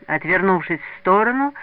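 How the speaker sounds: ordinary delivery, nothing marked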